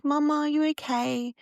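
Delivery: a hint of a worried toddler's voice; imitating her little daughter.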